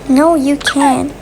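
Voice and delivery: ordinary talk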